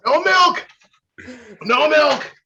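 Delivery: high, loud strained refusal; strained shout. Real, not acted. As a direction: force it out through the burn. Refusing milk in pain.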